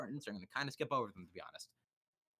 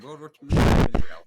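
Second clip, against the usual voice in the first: slow, booming proclamation, much louder; dramatic declaration; 18 dB louder, slower than usual. Pretend. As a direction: announce it like a grand revelation. Mock conspiracy bit to Scully.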